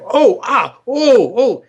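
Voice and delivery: comic patient yelping in a joke; whooping and hollering. Retold or imitated. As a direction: holler in mock pain, high and loud. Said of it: "you hear him whooping and hollering".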